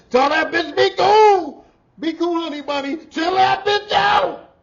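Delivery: high, loud, slow movie-villain yelling; Samuel Jackson impression. Imitating Samuel Jackson at a man, loud and slow. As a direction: channel the Pulp Fiction diner standoff, loud. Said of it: "Samuel Jackson on Pulp Fiction".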